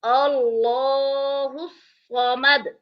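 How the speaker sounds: drawn-out, chanted and even; slow Quranic recitation. Shown as a demonstration. Teacher reciting the verse for students, slowly chanted. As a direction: recite each syllable long and melodic. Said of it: "हम पढ़ेंगे".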